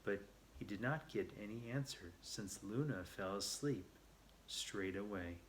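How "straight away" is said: ordinary delivery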